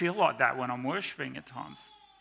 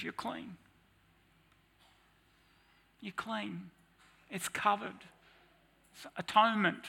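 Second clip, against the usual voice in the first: soft, hushed and higher, gently reassuring; tender whisper of God; 6 semitones higher, 5 dB quieter than usual. Acted. God softly telling the prophet he is clean, hushed and tender. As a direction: breathe forgiveness over the prophet.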